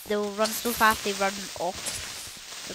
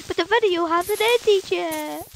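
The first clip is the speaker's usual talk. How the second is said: higher-pitched mock whine; put-on complaint, pitched up; protesting viewer; 7 semitones higher than usual. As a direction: imitate a kid protesting. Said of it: "You're like".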